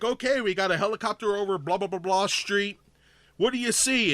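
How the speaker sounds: lightly voiced radio news host; imitating news anchor. Radio anchor handing over to the helicopter, lightly mimicked. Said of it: "like you're listening to the news".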